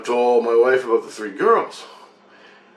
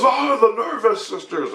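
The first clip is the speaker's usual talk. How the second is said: laughing high voice; 11 semitones higher than usual. Retold or imitated. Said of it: "And he goes".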